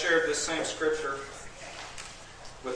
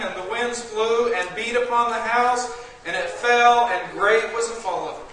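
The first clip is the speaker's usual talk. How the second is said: rising fiery preacher's voice; preaching; 7 semitones higher, 5 dB louder than usual. Acted. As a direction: preach it with building force. Preaching scripture at full force.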